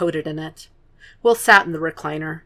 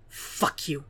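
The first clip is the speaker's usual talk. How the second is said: seething; 2 semitones lower, 10 dB quieter than usual. Acted. Will seething a curse at Red Dragon into the carpet. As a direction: spit it into the carpet, barely voiced. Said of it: "he seethed into the carpet".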